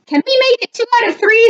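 high, fast character voice; shark bargaining. A narrator's reading. Shark asks for a rematch on railroad tracks, high quick plea.

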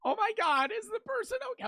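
high, whiny imitation of her sobbing; mock crying. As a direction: mimic her teary panic. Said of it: "immediately started crying".

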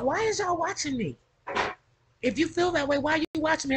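high-pitched imitation of her; imitating her challenge. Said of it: "she be like".

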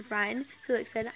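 ordinary delivery, nothing marked

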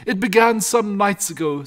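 high, loud storytelling shepherd; shepherd father. Acted. Shepherd starting his tale to the family, agitated.

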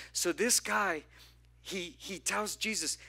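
fiery sermon voice; preaching build. Acted. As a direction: press the plea harder with each line.

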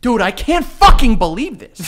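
slow, loud, laughing exasperation; exasperated disbelief. Real, not acted. Friend worked up over haircut spending, exasperated disbelief.

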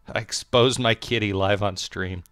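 ordinary talk

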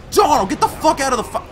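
high, loud, rushed outburst; real frantic yell. Real, not acted. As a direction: yell it fast and high, getting cut off. Yelling at himself in frantic game moment.

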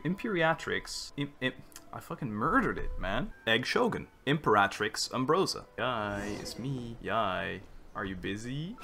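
ordinary talk

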